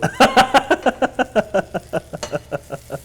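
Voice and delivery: a high bright burst of laughter; delighted laugh. Real, not acted. Cook laughing after Joe says very good, high laugh. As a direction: laugh with pleasure at the praise.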